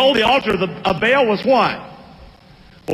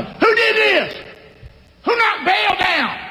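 higher, shouted townsmen demanding who did it; mock angry crowd; 11 semitones higher, slower than usual. Pastor voicing angry townsmen in a high shout. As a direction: bellow as an angry mob.

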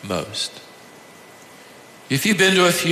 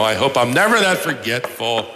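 ordinary talk

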